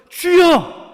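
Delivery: a preacher showing the cry of prayer; crying out Lord. Shown as a demonstration. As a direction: fling 주여 upward with all your strength. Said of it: "이렇게 해야 하는 것 아닙니까?".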